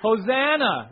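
crowd's yell. Retold or imitated. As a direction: call it out like a cheering crowd. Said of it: "people are yelling what?".

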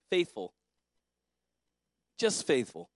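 ordinary talk, nothing marked

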